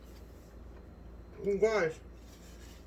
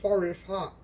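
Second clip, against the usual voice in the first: mouth full. Real, not acted. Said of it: "I never like talking with my mouth full".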